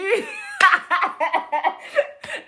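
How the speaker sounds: long high laugh climbing up; high rising laugh. Real, not acted. Laughing at what the pater said, high rising laugh. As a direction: shriek with laughter.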